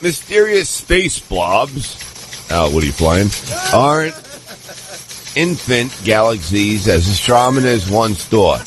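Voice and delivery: ordinary talk, nothing marked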